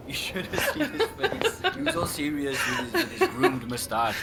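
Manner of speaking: laughing through the words; can barely keep from laughing while telling it. Acted. Laughing while retelling a funny story.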